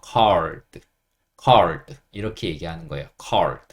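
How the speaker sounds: called as English card, r before the d, pitched low and falling; English R blended in. Shown as a demonstration. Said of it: "약간 리을 소리를 섞어줘서".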